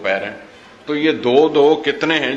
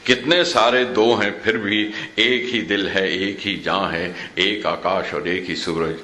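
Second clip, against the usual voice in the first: measured verse, sinking lower and softer; low, hushed final lines of a recited poem; poem recitation; 6 semitones lower, 4 dB quieter than usual. Acted. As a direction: bring the poem gently down to its close. Recites the poem's closing lines.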